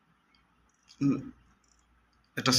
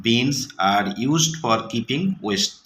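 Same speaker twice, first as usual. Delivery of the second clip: slow, deliberate sentence; slow reading aloud; much slower than usual. Shown as a demonstration. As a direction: read it slowly for the class. Teacher reading example. (ধীরে পড়া)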